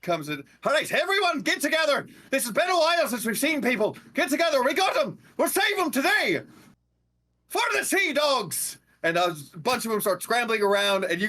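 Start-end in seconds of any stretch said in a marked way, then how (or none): 0.6-8.8 high, fast rousing sailor cry; rally the crew like a sea dog. Acted. Sea dog rallies crew to rescue, rousing high cry. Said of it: "another accent".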